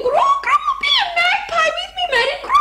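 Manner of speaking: very high warbling bird squawk; magpie. Pretend. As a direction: screech and warble as a pretend magpie. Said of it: "me being a cockatoo and a magpie".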